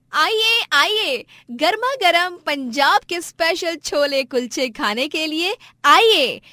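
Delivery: high, loud sing-song hawking; street vendor's call. Acted. Stall owner calls customers to chole kulche, hawking loudly. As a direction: call out with stretched words.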